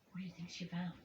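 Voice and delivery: soft, fast, breathy near whisper; hushed question. A narrator's reading. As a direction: lean in and whisper to the child. Asks listening child, hushed.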